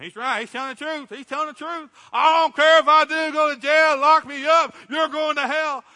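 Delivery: high, loud, hammed-up amen-corner shouting; mimicking a street evangelist shouting at the crowd downtown; mock preacher yelling. Second street preacher backing him up.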